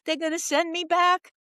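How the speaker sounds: high, panicked gasp of dread; retold panic. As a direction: relive the terror of going back to prison. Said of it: "Again, that fear".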